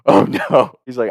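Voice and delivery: ordinary delivery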